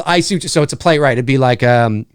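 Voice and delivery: usual speaking voice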